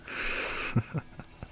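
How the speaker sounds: short chuckle, mostly breath; breathy laugh at a surprising find; amused chuckle. Acted. Amused at discovering a pulse.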